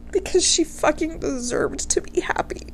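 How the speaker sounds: high, soft, whispered through tears; choked whisper of love and grief; real emotion, barely voiced; tearful whisper. Real, not acted. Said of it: "I'm gonna get emotional".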